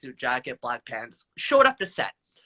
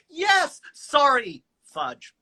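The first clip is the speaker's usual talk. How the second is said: ordinary talk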